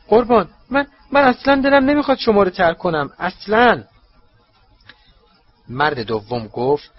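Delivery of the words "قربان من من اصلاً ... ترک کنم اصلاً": much higher, louder and fast, panicking; high, rushed desperate pleading; desperate protest. A narrator's reading. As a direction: plead frantically not to be doubted.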